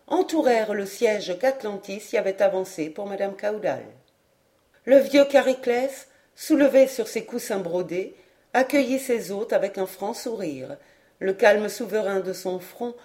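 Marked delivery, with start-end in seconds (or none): none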